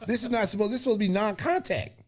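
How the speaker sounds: retold protest. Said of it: "And I said".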